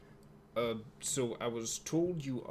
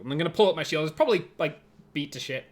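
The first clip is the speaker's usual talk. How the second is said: ordinary delivery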